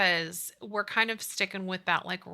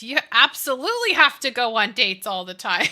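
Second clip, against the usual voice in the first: laughing; 3 semitones higher, 9 dB louder than usual. Retold or imitated. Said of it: "he goes".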